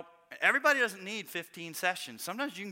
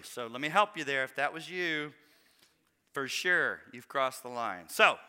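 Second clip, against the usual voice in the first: usual speaking voice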